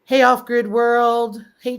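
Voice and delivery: usual speaking voice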